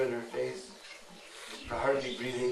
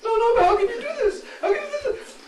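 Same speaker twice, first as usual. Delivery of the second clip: frantic pleading; 8 dB louder, faster than usual. Acted. Jatila pleads again.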